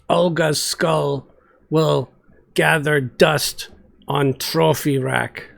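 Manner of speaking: slow, blunt monster woman; grim and heavy, in broken words; brutish Olga voice. Acted. Olga the brute speaks.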